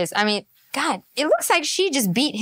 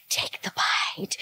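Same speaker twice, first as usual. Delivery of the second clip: "Take the bite." breathed out slowly, with no voice; tempting whisper; 8 dB quieter, slower than usual. Retold or imitated. Imitating the tempter urging a bite, slow whisper. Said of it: "This woman is like".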